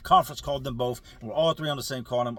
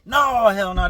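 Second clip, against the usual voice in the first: loud, higher-pitched protest; emphatic denial; 6 semitones higher, 11 dB louder, slower than usual. Retold or imitated. Friend denies rumor of twenty-seven years, emphatic protest. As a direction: shoot the rumor down loudly.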